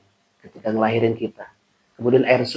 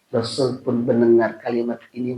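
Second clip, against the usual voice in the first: ordinary talk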